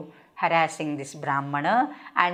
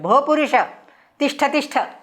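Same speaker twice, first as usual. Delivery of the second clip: commanding call; 6 semitones higher, faster than usual. Acted. Bhima ordering Ghatotkacha to halt, commanding call. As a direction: order him to stop where he stands.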